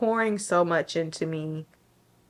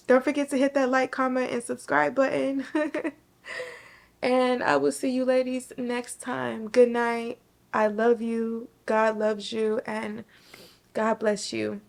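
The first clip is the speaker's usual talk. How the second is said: bright, higher outro voice after crying; cheery sign-off; 6 semitones higher than usual. Real, not acted. Signing off to viewers after tearful talk, bright higher goodbye. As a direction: lift the voice for a warm goodbye.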